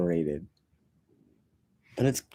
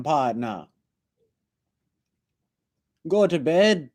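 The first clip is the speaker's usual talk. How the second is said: put-on Jamaican accent; 4 semitones higher than usual. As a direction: play a Rasta barman throwing someone out. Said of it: "I wish I talked like that".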